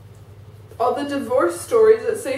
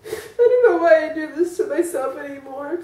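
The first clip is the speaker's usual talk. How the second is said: crying. Real, not acted. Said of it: "I'm sorry".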